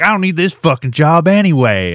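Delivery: hammed-up fired worker storming off; over-the-top defiant quitting voice; Tara. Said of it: "her getting fired from her job".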